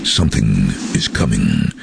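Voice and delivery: low, ominous trailer announcer; movie trailer voice. Retold or imitated. Voice artist recording the Jurassic Park trailer, low and portentous. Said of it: "the first line was something".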